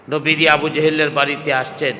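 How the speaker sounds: normal talking voice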